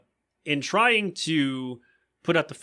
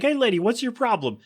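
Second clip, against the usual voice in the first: high, cartoonish indignation; squeaky snowman protest; pitched-up indignant snowman; mock indignant Frosty; 9 semitones higher than usual. Frosty confronting Lois.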